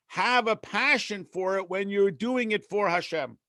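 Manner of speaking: ordinary talk, nothing marked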